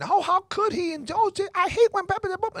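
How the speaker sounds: high mock whiny outburst; mocking emotional outburst. Mimicking the girlfriend's outbursts during the movie, high mock voice. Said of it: "blurting out these emotions".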